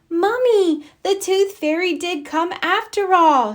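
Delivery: high, loud excited shout. A narrator's reading. Girl telling Mummy the fairy came, high excited shout. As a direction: shout the good news, thrilled. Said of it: "she shouted".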